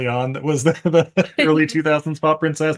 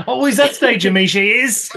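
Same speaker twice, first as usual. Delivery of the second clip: high, louder hammed-up Cockney; mock Cockney; 9 semitones higher, 5 dB louder than usual. Podcaster riffing on Angela Lansbury, mock Cockney. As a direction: sing out a silly Cockney parody.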